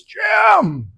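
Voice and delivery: high, loud happy greeting shout; delighted shout of a name. Retold or imitated. Man at the door greets Jim like an old friend, delighted shout.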